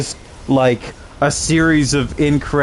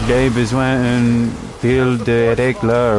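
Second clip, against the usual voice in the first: slow, broken mock French accent; slower than usual. Boyfriend repeating the French phrase, in a slow mock French accent. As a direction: drag out the bad French. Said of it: "And he's like".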